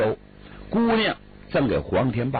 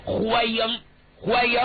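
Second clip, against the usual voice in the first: hearty welcome; 9 semitones higher than usual. Acted. Official welcomes warmly.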